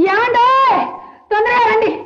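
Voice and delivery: high, loud call across the house. Acted. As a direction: call the husband in a panic. Wife calls husband urgently. (భర్తను కంగారుగా పెద్ద గొంతుతో పిలవడం)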